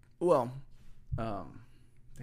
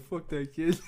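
mock dismissive; 6 semitones higher, 3 dB louder, slower than usual. Mimicking coworker after breakup.

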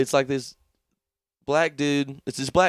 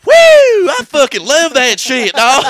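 a hyped dealer shouting his joy; wild excited whoop; 14 dB louder than usual. Retold or imitated. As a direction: whoop it out loud and high, thrilled. Acting out Tuco loving meth.

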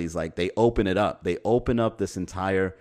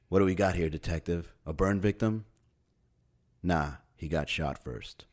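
lightly played crime-show investigator; TV crime-scene detective; 3 semitones lower, 5 dB quieter than usual. Detectives leaning over a roast pig, mock crime-show delivery. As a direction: sober mock detective talk, dropping lower and softer. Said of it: "like it's an episode of C S I".

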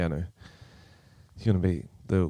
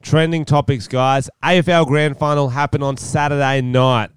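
louder host announcement; show host intro; 4 semitones higher, 5 dB louder than usual. Acted. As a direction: announce the segment brightly. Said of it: "segment announcement".

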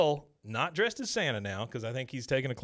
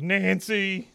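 calling out; 8 semitones higher, 5 dB louder than usual. Retold or imitated. Dale calls out walking off.

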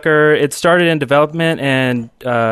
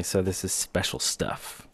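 conspiratorial whisper; 7 semitones lower, 14 dB quieter than usual. Pretend. Host teasing invite-only secret.

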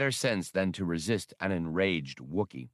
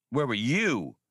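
raised, urgent call to his partner; 3 semitones higher than usual. A narrator's reading. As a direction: call out to the partner who finally showed up. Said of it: "Han cried".

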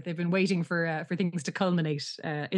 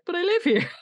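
retold protest, much higher and louder; baffled protest; 11 semitones higher, 4 dB louder than usual. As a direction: act out the guy's confused objection. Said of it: "this guy's like".

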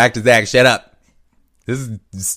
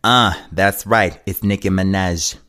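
[name]. Acted. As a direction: draw it out with diva attitude. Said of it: "now I got [name] mode".

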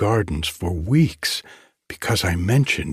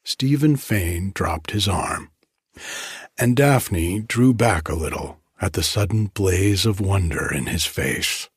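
usual speaking voice

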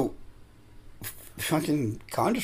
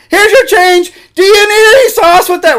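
loud shrill drive-thru lady; 14 dB louder than usual. Retold or imitated. Imitating the drive-thru lady blaring over the speaker, high and loud. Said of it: "That's her voice".

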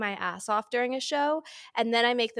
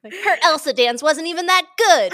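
mock catty talk-show host, louder, breaking into laughs; Andy Cohen voice; 5 semitones higher, 7 dB louder than usual. Mocking Bravo fans to her co-host, in a hammed-up Andy Cohen voice. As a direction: sneer at the fans' gripes like a gossipy TV host, laughing. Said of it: "I'm doing my Andy Cohen voice".

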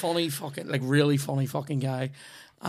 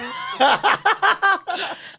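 big laugh; 6 dB louder than usual. Real, not acted. After punchline, big laugh.

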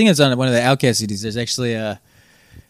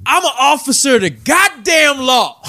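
imitating a drunk man's high, slow shout; retold drunk's indignant yell, pitched way up; drunk yelling cop claim; 12 semitones higher than usual. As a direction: bellow it like a drunk insisting on his authority. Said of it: "he would just yell that".

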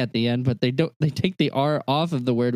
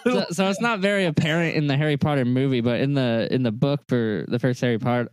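ordinary talk

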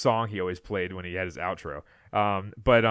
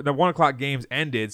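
normal talking voice

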